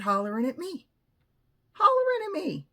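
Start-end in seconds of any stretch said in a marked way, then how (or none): 1.7-2.7 high-pitched indignant repeat; high squeal of disbelief. Real, not acted. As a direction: repeat it in high, incredulous outrage. Indignant repeat of what happened.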